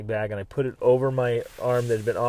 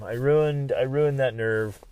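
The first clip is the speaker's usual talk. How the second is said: usual speaking voice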